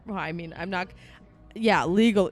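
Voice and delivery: ordinary delivery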